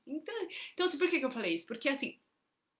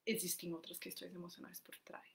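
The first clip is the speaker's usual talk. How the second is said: confiding whisper; hushed aside; 3 semitones lower, 12 dB quieter than usual. Shown as a demonstration. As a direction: whisper it like a secret. Whispered key point.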